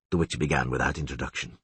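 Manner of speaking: ordinary delivery, nothing marked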